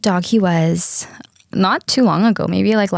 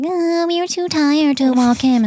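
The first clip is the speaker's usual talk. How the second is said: parents' excuse; 9 semitones higher than usual. Retold or imitated. Parents excusing not walking the dog, high whiny excuse. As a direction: whine the excuse like a lazy parent. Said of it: "And like".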